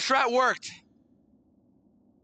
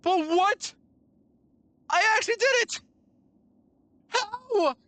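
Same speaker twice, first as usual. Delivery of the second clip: disbelieving; 11 semitones higher than usual. Real, not acted. Disbelief after the win.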